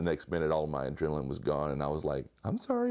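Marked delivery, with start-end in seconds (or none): 2.4-2.9 high, meek little squeak; mock wimpy apology. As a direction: squeak it out, mocking your cowed younger self. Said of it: "like a little bitch".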